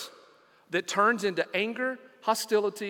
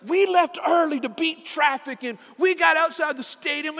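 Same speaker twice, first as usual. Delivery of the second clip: higher, louder, imitating a furious girl; angry daughter; 8 semitones higher, 5 dB louder than usual. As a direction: retell the daughter's rising anger, nearly shouting. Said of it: "she's getting angry".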